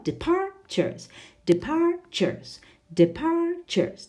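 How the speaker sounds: departures said slowly, PAR punched high; drilling departures for students; stress on second syllable. Shown as a demonstration. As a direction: model de-PAR-tures slowly, leaning on the middle syllable. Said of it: "it's on the second".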